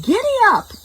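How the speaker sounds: high shouted giddy up; excited farmer yell. Acted. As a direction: call out high like riding a horse. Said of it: "he yelled".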